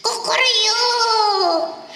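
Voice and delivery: high, drawn-out cock-a-doodle-doo; slow imitated rooster call; rooster crow. A narrator's reading. Rooster crow marks morning.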